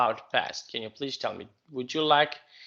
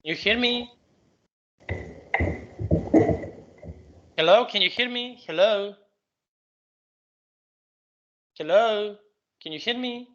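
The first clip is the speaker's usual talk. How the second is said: call dropping out; 6 semitones higher than usual. Real, not acted. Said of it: "What are you saying? I can't hear you".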